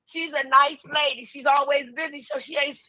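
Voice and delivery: ordinary delivery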